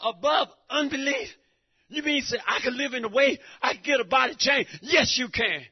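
full-force gospel sermon; preaching. Acted. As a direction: preach it hard to the congregation. Preaching rhetorical questions.

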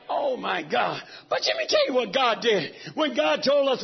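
normal talking voice

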